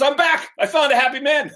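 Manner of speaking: high, loud, jubilant call; triumphant announcement. Acted. King returns to the queen, triumphant and loud. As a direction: burst in proclaiming good news.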